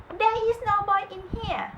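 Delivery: high covering wife's voice; giant's wife. A narrator's reading.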